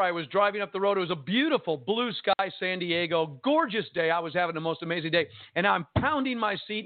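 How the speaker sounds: ordinary talk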